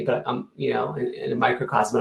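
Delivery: ordinary talk, nothing marked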